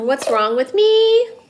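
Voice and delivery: high, loud, slow mock wail. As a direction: wail "me" slowly, mock distressed. Mock self-despair.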